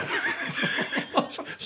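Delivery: squeaky wheezing laugh; gleeful airy squeal of laughter; high breathy laugh. Real, not acted. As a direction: wheeze out a high giggle of glee. Laughing at his win.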